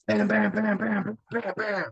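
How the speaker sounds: high rapid bam bam knocks; imitated door banging. Locked out at night, banging on the door with mock knocks. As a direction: pound out the knocks with your voice.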